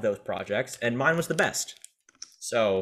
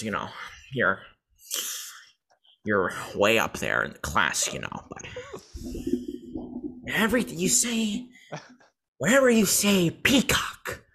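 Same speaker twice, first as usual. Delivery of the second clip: Joker voice; 2 semitones higher than usual. Acted. Podcaster showing off a Joker voice to a friend, hammed-up Joker. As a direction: taunt like the Joker, halting between phrases. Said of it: "my Joker voice is better".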